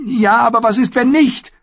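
hartnäckiges Mädchen; insistent young Arya. A narrator's reading. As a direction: push back, not giving up. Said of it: "beharrte sie".

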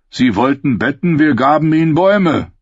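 mocking outlaw voice; sardonic Hound. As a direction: deliver it as a cruel joke.

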